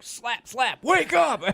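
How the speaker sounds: high, loud mock shout at the dummy. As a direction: slap the dummy and yell at it, hammed up. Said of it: "I was like".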